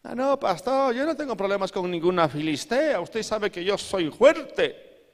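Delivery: high, cocky voice of a proud churchgoer; mock boastful believer. Preacher mimicking a proud church member, cocky and high.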